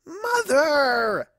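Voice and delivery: whiny mortified cry of Mother; a daughter cringing at her parent; embarrassed teen daughter. Acted. Daughter mortified by her mother, whiny cry.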